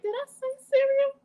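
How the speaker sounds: high-pitched mock self-doubt; questioning oneself. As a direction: act out doubting your own words. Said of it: "she be having you question yourself".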